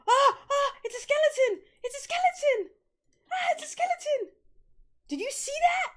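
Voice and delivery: high, loud terrified screams; panicked screaming at a skeleton. Real, not acted. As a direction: shriek it out at the skeleton. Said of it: "I scream really loud".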